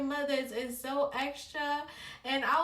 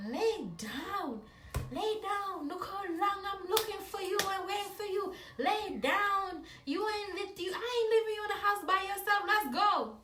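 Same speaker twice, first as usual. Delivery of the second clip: a higher, insistent Jamaican mom; mom refusing; 8 semitones higher than usual. Retold or imitated. Mother refusing to leave daughter home, higher insistent voice. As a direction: repeat it like a mom who won't take no. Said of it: "she was like".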